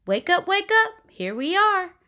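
high, loud sing-song wake-up call. Acted. Mama rousing sleepy llama in the car, high bright call. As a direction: rouse a sleepy child cheerfully.

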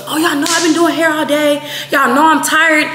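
put-on high complaint; mock complaining. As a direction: mimic a friend pleading tiredness. Mimics friend's excuse for moodiness.

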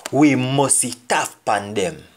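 We must tough pan them, slowly and clearly; slow patois demo; slow Jamaican patois. Shown as a demonstration. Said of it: "we say".

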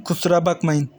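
ordinary delivery

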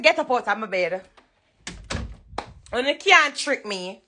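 usual speaking voice

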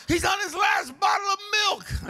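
high, loud fretting wife; anxious wife. Retold or imitated. Preacher plays wife fretting over milk, high and shrill. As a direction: cry it out like a frantic mother. Wife voiced in retold story.

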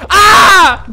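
loud high scream; startled yell. Real, not acted. Gamer ambushed by enemies, loud startled scream. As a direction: yell as they jump you.

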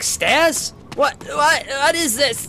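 high, whiny baffled zombie voice; zombie baffled by stairs. Retold or imitated. Gamer voices a zombie stumped by stairs, high and whiny. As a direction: play a dim zombie defeated by a few steps. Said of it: "He's like".

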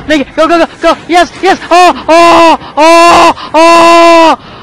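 loud rising frightened cries; panicked. Real, not acted. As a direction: panic as it closes in. Panicking while escaping.

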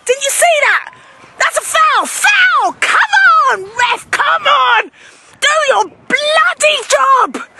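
angry sideline abuse hurled at the referee; furious, shouting it across the field; outraged parent laying into the referee; ranting at the ref. Acted.

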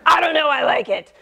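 quick, laughing brush-off; laughing shrug. Retold or imitated. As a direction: toss it off fast through a laugh. Answering her kids about loud music.

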